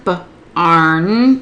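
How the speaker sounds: BARN split into a short B, then ARN; finger-spelled sounds. Shown as a demonstration. Tutor showing additional support, BARN sounded out in parts. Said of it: "we could have them finger spell barn".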